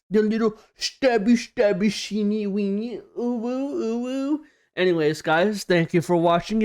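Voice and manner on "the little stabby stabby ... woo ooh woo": higher, playful nonsense singsong; teasing singsong. Pretend. Teasing his friend about the stab scar, playful singsong. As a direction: croon it to tease a friend.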